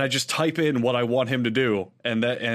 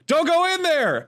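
much higher, slow warning call; mock theater heckler; 4 dB louder than usual. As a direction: call out to the screen. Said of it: "some guy in the movie theater goes".

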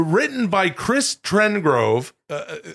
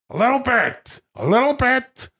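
high-pitched mannered catchphrase; Al Pacino doing Robert De Niro; 8 semitones higher than usual. Acted. Pacino's mimicry of De Niro getting carried away, high and mannered. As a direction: push the impression up high. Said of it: "This is Pacino's impression of De Niro".

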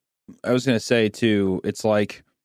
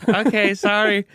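small, higher and slow meek apology; sheepish kid; 8 semitones higher than usual. Retold or imitated. Young boy apologizing to his scolding grandpa, meek and high. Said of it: "And I'm like".

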